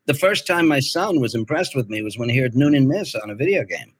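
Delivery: ordinary delivery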